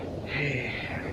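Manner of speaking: a long, soft, fed-up sigh; weary sigh. Acted. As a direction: sigh it out, tired of the bragging. Fed up with the boastful commander.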